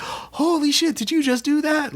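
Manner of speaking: high astonished shout of disbelief; amazed. Retold or imitated. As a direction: gasp it out, way up high. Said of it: "And it's just like".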